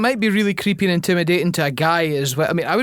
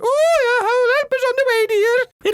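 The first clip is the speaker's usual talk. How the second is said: Mrs Doubtfire voice. Pretend. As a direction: squeal it out like a granny. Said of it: "can't do a Robin Williams voice".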